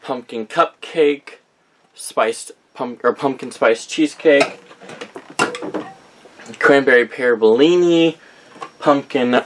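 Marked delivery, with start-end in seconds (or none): none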